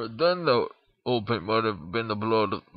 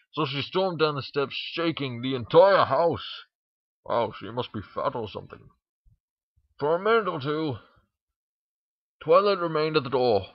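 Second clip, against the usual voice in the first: ordinary delivery, nothing marked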